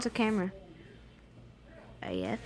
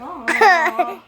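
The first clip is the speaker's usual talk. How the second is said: big sneeze; 4 semitones higher, 7 dB louder than usual. Pretend. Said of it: "the loudest sneeze you've ever heard challenge".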